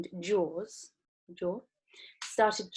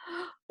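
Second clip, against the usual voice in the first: sharp gasp; 11 semitones higher, 9 dB quieter than usual. Acted. Shocked reaction in story.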